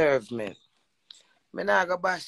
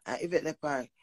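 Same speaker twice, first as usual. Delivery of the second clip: ordinary talk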